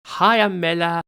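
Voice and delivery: usual speaking voice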